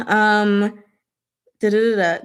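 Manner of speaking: ordinary talk, nothing marked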